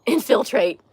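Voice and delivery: amused, laugh breaking into the word. Real, not acted. Laughing at her own word.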